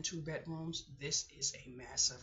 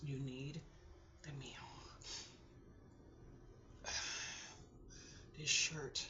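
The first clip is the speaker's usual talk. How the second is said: soft, halting self-coaxing between sighs; holding back tears; 5 dB quieter than usual. Real, not acted. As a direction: coax yourself along in small, breathy pieces. Coaxing herself to eat, fighting tears.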